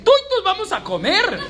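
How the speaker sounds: put-on high, laughing Boyacá country accent. Preacher jokingly imitating Boyacá locals, high Boyacá accent. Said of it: "los boyacenses dicen".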